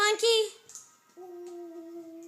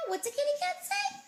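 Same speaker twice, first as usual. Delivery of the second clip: high singsong; 13 semitones higher, 4 dB quieter, faster than usual. Real, not acted. Parent coaxing toddler, high.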